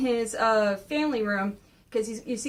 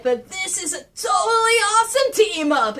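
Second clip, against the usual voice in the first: high, loud battle cry; shouting; 7 semitones higher, 5 dB louder than usual. Acted. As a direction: yell it out at the enemy. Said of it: "Leo was shouting that".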